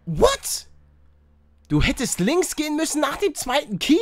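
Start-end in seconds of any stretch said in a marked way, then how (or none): 0.1-0.7 high disbelieving squeal; shocked squeak. Real, not acted. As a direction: yelp the word in shock. Shocked by sudden death.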